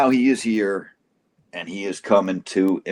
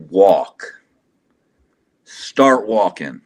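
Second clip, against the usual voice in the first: loud, slightly raised order; retold firm command; 6 dB louder, slower than usual. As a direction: quote a firm order, punching each word.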